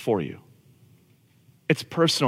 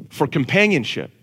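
ordinary talk

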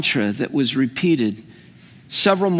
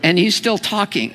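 ordinary talk, nothing marked

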